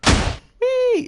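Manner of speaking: high squeal of delight; excited whee. Real, not acted. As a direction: squeal like on a rollercoaster. Launched into the air.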